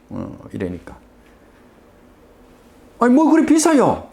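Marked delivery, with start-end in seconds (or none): 3.0-4.1 indignant surprise. Retold or imitated. Customer balks at the price.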